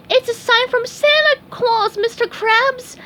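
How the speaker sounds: high, loud frightened sponge; scared cry. Acted. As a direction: cry out in alarm. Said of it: "SpongeBob cried".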